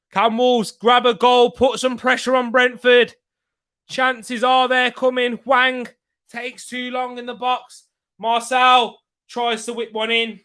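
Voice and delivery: keen match commentator; live play-by-play. Real, not acted. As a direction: urge the attack on as you call it.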